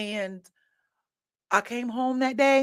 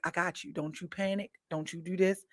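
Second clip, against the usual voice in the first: reassuring; 3 semitones lower, 11 dB quieter than usual. Retold or imitated. God reassuring her inner voice.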